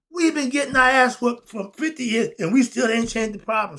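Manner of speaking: exasperated preaching to the crowd; heated rant. Real, not acted. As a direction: vent it like a preacher fed up. Ranting about decades of struggle.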